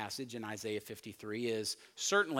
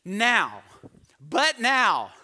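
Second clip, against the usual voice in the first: loud, high "but now"; Jesus turning the lesson, loud "but now"; emphatic but now; 5 semitones higher, 10 dB louder, much slower than usual. Retold or imitated. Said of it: "It's like".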